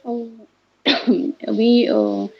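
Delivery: ordinary talk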